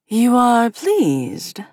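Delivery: slow, strange otherworldly voice; drawn out, inhuman and wary; slow creature voice. Acted. A creature questions her.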